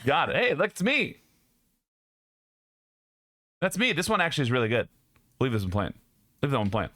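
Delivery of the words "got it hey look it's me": ordinary delivery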